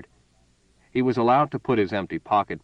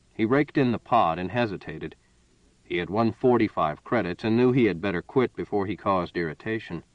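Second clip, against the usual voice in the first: usual speaking voice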